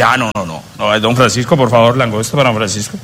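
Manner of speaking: mock modest host. Husband pretends to refuse lobster for guest, deep voice. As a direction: play the gracious host, deep and pompous.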